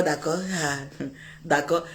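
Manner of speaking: d'accord grave et très doux, étiré; lâche les d'accord tout bas, lentement; d'accord à mi-voix. Retold or imitated. (d'accord murmuré, lent et résigné)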